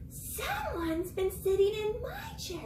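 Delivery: high-pitched Mama Bear. Acted. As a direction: lift it into a slow mother bear. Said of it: "Mama Bear says".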